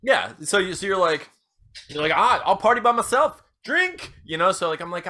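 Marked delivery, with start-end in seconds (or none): 2.1-4.1 high, loud party bravado; defiant party animal. Acted. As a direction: push the drinking on, voice jumping up. Acting drunk host left alone.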